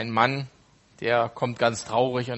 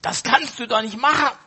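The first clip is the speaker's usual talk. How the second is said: high, loud protesting cry; pitched-up shouted protest; 11 semitones higher, 4 dB louder than usual. Retold or imitated. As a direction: yell it to stop the deed in horror. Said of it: "schreit der Einsiedler".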